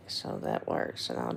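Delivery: hoarse, strained throat; losing voice. Real, not acted. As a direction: voice worn out and scratchy. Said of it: "my throat is gone".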